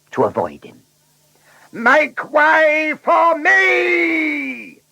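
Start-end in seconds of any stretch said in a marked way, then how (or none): 1.7-4.8 very high, long-stretched yell; roaring. A narrator's reading. Blunderbuss forcing other cars aside, roaring. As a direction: bellow it, drawing out every word. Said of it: "he roared".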